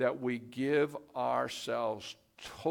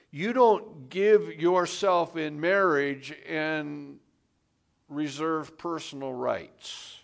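ordinary talk, nothing marked

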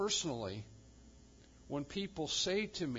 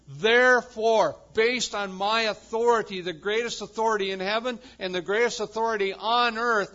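loud, ringing sermon proclamation; preaching at full force; 5 semitones higher, 10 dB louder than usual. Acted. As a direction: proclaim it to the whole congregation. Preaching Jesus' authority.

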